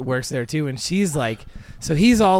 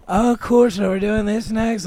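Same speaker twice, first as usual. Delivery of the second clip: spaced-out coworker; 7 semitones higher, 4 dB louder than usual. Retold or imitated. Imitating a dazed, medicated coworker.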